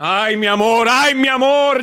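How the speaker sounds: mock adoration; mimicking gushing admirer. Echoes woman's clip just played.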